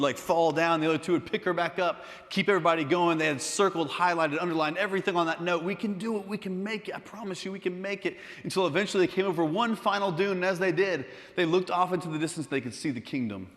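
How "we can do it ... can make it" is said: higher but softer pleading pep talk; acted earnest urging, pitched up; urging encouragement. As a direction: egg on worn-out companions. Hags urge each other on.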